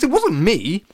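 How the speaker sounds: pleading, high and loud; Alex. A narrator's reading. Alex defends himself to the crowd, pleading. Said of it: "pleaded Alex".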